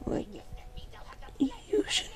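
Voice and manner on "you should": real slip as the voice breaks upward; voice cracking. Real, not acted. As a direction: voice cracks up high on "you". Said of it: "Oh, my voice".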